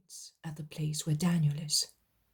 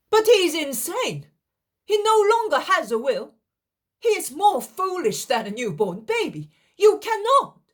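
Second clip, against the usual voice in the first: loud, high desperate objection; alarmed protest; 13 semitones higher, 8 dB louder than usual. Acted. Daniel arguing that the sick man is insane, loud high shout. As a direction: shout him down in panic.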